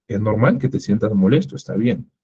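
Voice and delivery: normal talking voice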